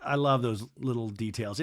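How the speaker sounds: ordinary talk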